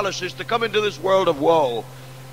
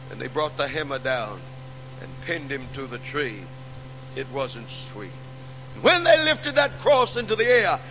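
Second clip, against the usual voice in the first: hushed sermon narration; 5 semitones lower than usual. Acted. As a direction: draw the congregation in close with a falling voice. Describes crucifixion, voice sinking.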